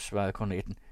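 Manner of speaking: usual speaking voice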